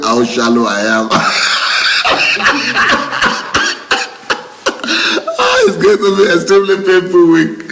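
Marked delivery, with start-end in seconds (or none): 1.1-5.3 a long, airy, high laugh. Real, not acted. Laughing at viewer comment. 5.4-7.6 much higher, louder, delighted repeat; excited. Real, not acted. Oh, now you are talking.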